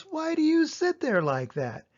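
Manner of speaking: high, playful cat voice; a lively cat teasing bored children; cat in the hat. A narrator's reading. Cat asking the children why they sit, playful.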